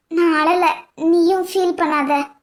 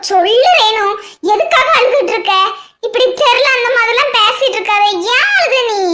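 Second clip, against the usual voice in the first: loud, higher, insistent questioning; exasperated concern; 3 semitones higher, 5 dB louder than usual. Acted. Friend demands why Renu is crying, loud and insistent. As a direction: press her hard for an answer.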